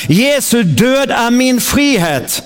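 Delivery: slow, proclaiming call for the congregation; preacher leading a spoken creed; leading a confession. Acted. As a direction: declare it for them to echo. Leads confession to repeat.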